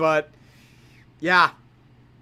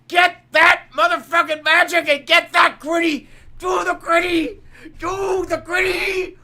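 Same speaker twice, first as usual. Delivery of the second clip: higher shouted chant; cheering; 5 semitones higher than usual. Real, not acted. Urging the players to dance, shouted cheer. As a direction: hype them into dancing the gritty.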